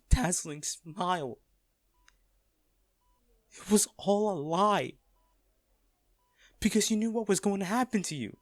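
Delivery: anguished outburst at a hospital bedside; distraught. Acted. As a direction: let the grief break through, building. Distraught over lover's sacrifice.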